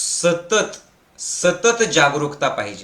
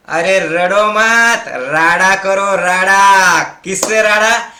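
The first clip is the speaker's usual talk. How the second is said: slow, loud, punchy Hindi wordplay; slow loud rallying call; loud rousing preaching; 2 semitones higher, 5 dB louder, much slower than usual. Acted. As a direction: stretch each word out to rally them.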